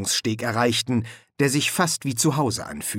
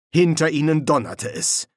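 plain reading voice

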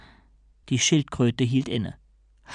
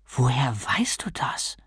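slow, hushed whisper, much softer; stunned whisper; 3 semitones lower, 5 dB quieter, slower than usual. Acted. Stunned novice asks how the turtle knows, whispering. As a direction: barely get the question out.

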